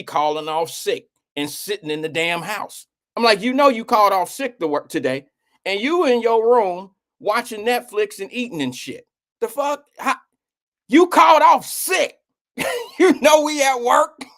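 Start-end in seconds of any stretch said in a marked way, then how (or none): 3.4-14.2 loud incredulous scolding, higher pitch; scolding dad. Retold or imitated. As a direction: chew her out in disbelief. Dad scolding daughter calling off sick.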